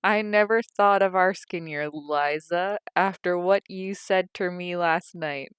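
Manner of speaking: Tom's voice. A narrator's reading. Tom replies hurt.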